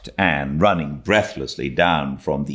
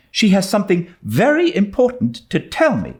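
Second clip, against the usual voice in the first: put-on high, louder girl's plea; pleading Anne; 4 dB louder than usual. A narrator's reading. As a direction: beg like an eager young girl.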